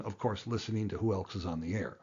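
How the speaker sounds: usual speaking voice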